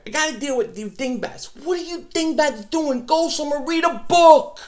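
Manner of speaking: high, sneering mock voice at the trolls; condescending high put-on voice. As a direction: lord it over the dingbats in a high sneer.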